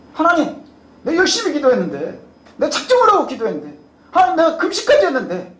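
high, aggrieved protest, acted; indignant complaint. Believer complaining to God despite fasting, indignant. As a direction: protest as if cheated of a reward. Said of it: "분노합니다".

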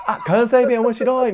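high, loud, drawn-out amused girl impression; mock a standard-Japanese girl's delighted reaction; 標準語の女の子. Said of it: "標準語の女の子と初めて喋るわけですよ". (標準語で面白がる女の子を高く大きく真似)

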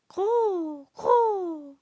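high, loud bird coo; drawn-out, high-pitched dove call; imitated dove coo.

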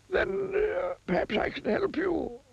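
put-on high, slow old wizard; a high, doddering wizard offering help. A narrator's reading. Wizard offering to help the miser.

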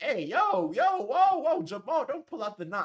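loud, high alarmed calls to stop him; alarmed yelling. Retold or imitated. Walking into the party, yelling at Jamal not to draw. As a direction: shout across the room to stop a gun being drawn. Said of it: "I'm just walking in like".

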